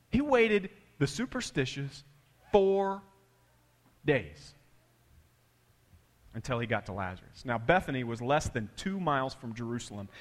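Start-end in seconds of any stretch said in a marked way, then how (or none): 0.1-4.5 slow, with long pauses before four and days; dramatic pause. Real, not acted. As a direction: let the count land with a pause.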